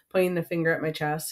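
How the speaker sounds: plain reading voice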